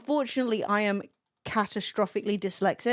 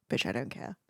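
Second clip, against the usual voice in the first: very low, soft dismissive retort; mock indifference; 11 semitones lower, 10 dB quieter than usual. Customer refusing to switch to English, voiced low and dismissive. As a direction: drop it low, mock-unbothered.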